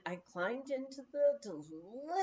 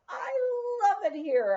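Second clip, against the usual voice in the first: joyful shout; 11 semitones higher, 7 dB louder than usual. A narrator's reading. Alone by the garden at night, shouting with delight. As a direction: call it out loud and high with delight. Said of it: "I shouted".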